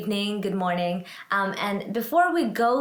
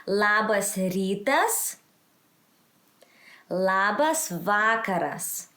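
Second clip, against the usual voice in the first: slow Labas rytas rising, slow Labas vakaras falling; language-teacher model; slower than usual. Shown as a demonstration. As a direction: model both greetings slowly for learners. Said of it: "So let's go again".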